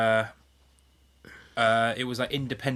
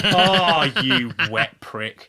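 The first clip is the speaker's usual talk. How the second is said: laughing "Oh" then a slow, drawn-out insult; loud, laughing put-down, slowly spelled out; laughing mock scorn; 3 semitones higher, 9 dB louder, much slower than usual. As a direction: jeer at him through laughter. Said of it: "And you're just like".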